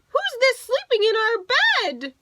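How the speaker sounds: very high, loud bear cry; crying little bears. Acted. Two little bears crying at the girl in their bed. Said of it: "they cried".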